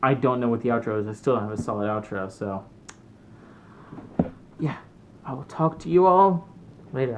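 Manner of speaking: ordinary delivery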